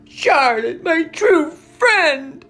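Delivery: high, loud, slow lament; anguished wail. A narrator's reading. Pig mourning his spider friend, anguished wail. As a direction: wail it as if losing a dear friend. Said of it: "he moaned".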